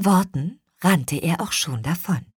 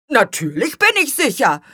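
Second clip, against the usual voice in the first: high, loud indignant retort; affronted insistence; offended; 8 semitones higher, 5 dB louder than usual. A narrator's reading. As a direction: answer back in a huff. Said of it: "gab Spargelzahn beleidigt zurück".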